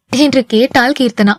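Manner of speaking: ordinary delivery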